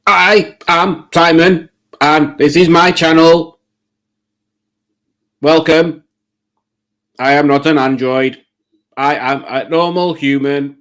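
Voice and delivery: stiff mock-android announcement, word by word; robot greeting. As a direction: pretend to be an android insisting it is human. Robot-like greeting insisting he is human.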